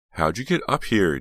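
high, loud, insistent question; demanding. A narrator's reading. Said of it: "Jade demanded".

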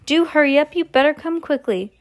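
high, loud urgent call; grandmother shouting. A narrator's reading. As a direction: grandmother calling out, pitched well up. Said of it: "it shouted".

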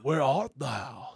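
very slow, drawn-out mock old English; mock Keanu Shakespeare. Mocking Keanu's stiff Shakespeare line, slow and drawn. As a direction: drag it out like a stiff nineties actor. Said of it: "that fucking voice".

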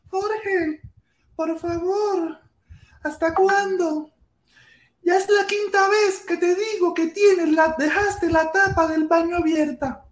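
high sweet scolding, slowed; sweet woman's voice. Retold or imitated. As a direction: coo it like a gentle girlfriend. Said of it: "Qué diferencia".

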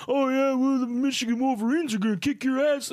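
loud, high mocking fan voice; boastful grown man. Mimicking a grown man taunting a kid over college shirts, mock boast. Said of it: "like a grown fucking man".

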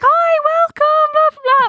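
high, drawn-out mock greeting; gushing hotel welcome. Hotel staff greeting the arriving family, exaggerated high-pitched welcome. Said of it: "they're all like".

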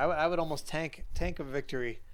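ordinary talk

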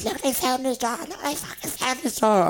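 high-pitched mock outraged ma; tattling mother. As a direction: imitate mum reporting it, voice pitched right up. Mother telling on him to dad.

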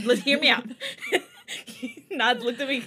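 laughing. Real, not acted. Laughs while making her point.

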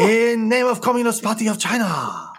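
put-on podcast host does a Mao accent for the letter; mock Mao accent. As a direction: read it as Mao would, hammed-up. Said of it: "Should I do this one in a Mao accent?".

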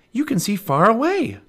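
put-on high excited girl's voice; little girl Annie. Annie calls to Jack, high excited little girl. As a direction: Annie calling out, bright and high.

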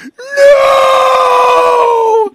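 high, loud wailing no; imitated anguished cry. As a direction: cry out in despair. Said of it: "His wife's crying".

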